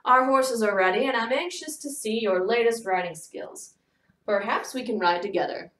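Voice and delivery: encouraging southern gentleman; a friendly invitation in a southern accent. A narrator's reading. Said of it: "encouragingly in his southern accent".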